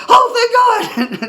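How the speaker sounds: very high, loud, drawn-out cry of relief; excited relief. Retold or imitated. Driver hugging the man he hit, excited relieved cry. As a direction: wail it out slowly, overjoyed and half laughing. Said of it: "I get excited easy".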